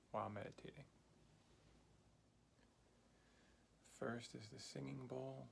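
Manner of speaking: soft, unhurried breathing guide; guided meditation. Acted. Teacher guiding a beginner's breathing exercise, soft calm meditation voice.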